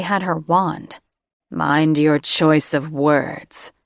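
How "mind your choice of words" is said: slow, growled warning; low growl. Acted. Drake warning Catherine off Sarah, slow growled threat. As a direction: let it rumble out as a slow threat. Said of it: "with a low growl".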